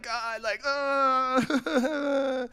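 high-pitched whining sob; mock crying. Believer bringing problems to God, mock sobbing. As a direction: imitate someone bawling their complaints. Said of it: "he sees us crying".

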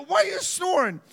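mock shout. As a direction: blurt it out at your friend. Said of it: "And I go".

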